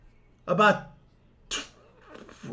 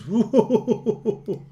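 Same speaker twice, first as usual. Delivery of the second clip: high, pulsing whoop of delight; high rippling cheer; celebratory whooping; 6 semitones higher than usual. Real, not acted. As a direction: whoop it up for the score. Celebrates the try just scored.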